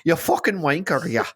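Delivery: hammed-up Irish brogue; Irish accent. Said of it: "it just turns into Irish".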